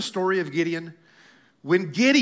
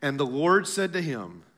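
ordinary talk, nothing marked

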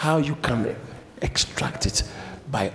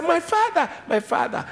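high, urgent call to a father; retold cry to a father; 13 semitones higher than usual. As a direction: call out high, falling on the repeat. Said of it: "he cried, Elisha cried".

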